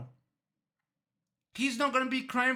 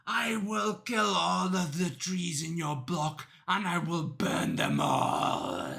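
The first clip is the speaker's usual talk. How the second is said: slow, low villainous growl; menacing threat; 5 semitones lower, slower than usual. Acted. Cop threatening all the killer's trees, slow low menace. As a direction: drag the threat out low and ominous. Cop's threat sinks lower.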